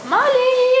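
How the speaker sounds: loud, high call across the room. Real, not acted. Girl searching for her lost dog, calling loudly. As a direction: shout the dog's name to find him.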